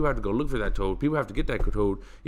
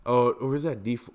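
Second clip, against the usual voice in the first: ordinary talk, nothing marked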